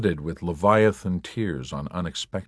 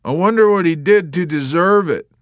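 high, loud lament; 11 semitones higher, 9 dB louder than usual. A narrator's reading. Warrant officer lamenting the dead soldier. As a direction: mourn it aloud.

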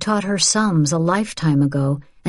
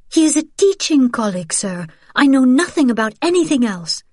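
frightened denial; 8 semitones higher, 4 dB louder than usual. Acted. Frightened woman denies to Gestapo.

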